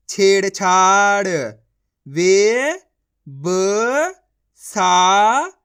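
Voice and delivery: loud, drawn-out reading of each syllable; slow syllable drill. Shown as a demonstration. Teacher sounding out words for young pupils, slow and loud.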